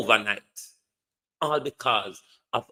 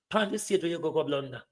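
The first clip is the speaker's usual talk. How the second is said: normal talking voice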